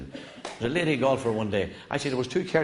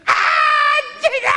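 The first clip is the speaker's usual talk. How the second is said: very high, loud, slow howl from beyond the trees; far-off pained shout; 11 dB louder, much slower than usual. Acted. Man hit by the ball howls from beyond the trees, long and loud. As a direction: stretch it into a long howl of pain. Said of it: "she heard the shout".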